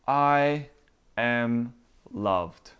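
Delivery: slow, each word held and set apart; deliberate affirmation. Acted. Believer telling himself he is loved, slow and deliberate. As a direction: draw out each word with a pause between.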